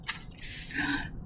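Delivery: sharp surprised gasp. Acted. Surprise in story.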